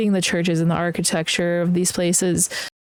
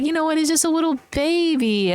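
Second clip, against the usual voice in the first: put-on cooing baby voice; 8 semitones higher than usual. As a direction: coo like it's a little baby. Cooing over mountain goat.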